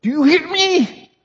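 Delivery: high, pressing plea; urgent high call to listen; urgent grab. Retold or imitated. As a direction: grab them by the collar with your voice. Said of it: "grabbing them and saying".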